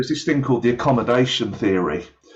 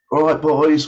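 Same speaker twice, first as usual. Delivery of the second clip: higher-pitched, laddish greeting to a gang; matey street greeting; 6 semitones higher, 4 dB louder than usual. Acted. Speaker walking past kids with beer, putting on street talk. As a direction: put on a casual, chummy street voice. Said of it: "I'll change the way I speak".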